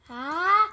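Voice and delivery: loud rising wind-up before sneeze; sneeze build-up. Acted. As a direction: gasp into a big sneeze.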